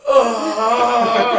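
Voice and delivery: loud, high drawn-out "oh" with laughter; acted relief groan. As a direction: groan it out like sweet release. Acting out relief while swimming.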